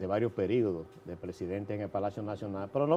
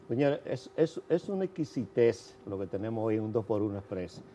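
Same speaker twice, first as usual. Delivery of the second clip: ordinary delivery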